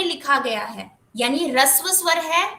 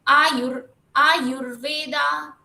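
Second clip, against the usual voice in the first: Ayurveda with यु stressed and drawn out; slow Ayurveda, weight on the syllable before र्व; 4 dB louder, much slower than usual. Shown as a demonstration. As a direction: lean on यु in Ayurveda, slowly. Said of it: "यू को थोड़ा जोर देके बोलेंगे".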